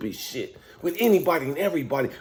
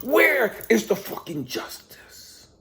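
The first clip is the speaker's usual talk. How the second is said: bitter whisper; 4 semitones higher, slower than usual. Real, not acted. Bitter question to God.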